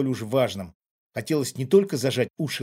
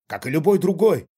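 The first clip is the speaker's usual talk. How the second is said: louder, higher comeback; defiant; 4 semitones higher, 7 dB louder than usual. A narrator's reading. Father claims his right to forget, defiant. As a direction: stand your ground with a challenge. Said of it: "с вызовом бросил отец".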